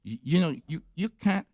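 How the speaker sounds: put-on stumbling French accent with mild frustration; frustrated French accent. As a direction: imitate a frustrated French boy groping for words. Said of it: "he was frustrated too".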